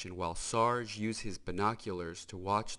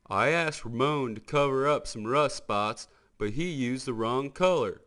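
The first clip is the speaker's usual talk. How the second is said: Mater's voice; 4 semitones higher, 6 dB louder than usual. Acted. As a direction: explain the mishap like a goofy country tow truck.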